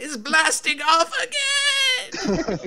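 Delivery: high, stretched-out hammed-up yell; screaming halfling. Game master plays halfling carried off by horse, screaming. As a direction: bellow it like a cartoon villain flung away. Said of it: "you just hear the halfling screaming".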